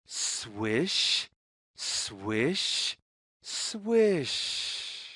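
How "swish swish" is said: ordinary delivery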